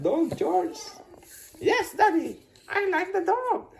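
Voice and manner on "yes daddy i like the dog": voz de criança. Acted. Child George answers happily.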